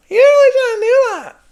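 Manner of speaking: very high, loud falsetto scold; mock a nagging critic in a squeal; exaggerated catty squeal; cunty imitation.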